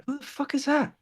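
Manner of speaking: high-pitched, irritated character voice; irritated nurse. Acted. Nurse with clipboard to intruders, irritated. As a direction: pitch it up and dismiss them.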